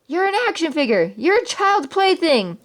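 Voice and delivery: high, slow, exasperated yelling at Buzz; ballistic outburst. Acted. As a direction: shout it out, losing patience. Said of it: "Woody went ballistic".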